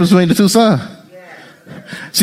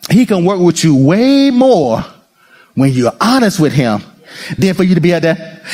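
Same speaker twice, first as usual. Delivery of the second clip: normal talking voice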